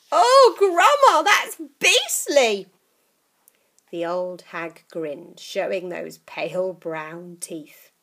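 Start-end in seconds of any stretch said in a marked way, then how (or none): 0.1-2.7 put-on higher, louder disgusted boy.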